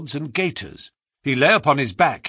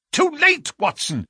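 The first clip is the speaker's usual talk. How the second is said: anguished cry; 13 semitones higher than usual. A narrator's reading. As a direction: cry out that it's too late. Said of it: "cried Holmes".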